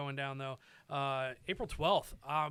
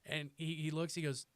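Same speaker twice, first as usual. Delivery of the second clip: usual speaking voice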